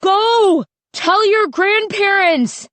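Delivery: high, loud panicked scream. A narrator's reading. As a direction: yell it out in terror to get them moving. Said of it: "Styx was screaming".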